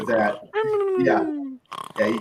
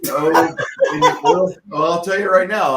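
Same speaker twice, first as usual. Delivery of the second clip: high, loud and slow, caught out; startled high-pitched hedging; flustered backpedal; 8 semitones higher, 8 dB louder, slower than usual. Real, not acted. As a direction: scramble to cover yourself, pitched way up. Backpedalling after offending player.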